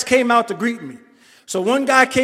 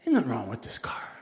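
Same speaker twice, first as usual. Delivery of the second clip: lower, much softer, whispered self-talk; muttering to himself; 4 semitones lower, 13 dB quieter than usual. Retold or imitated. Driving home, telling himself to calm down, whispered. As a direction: hush it down like you're talking yourself out of fear. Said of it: "I was like".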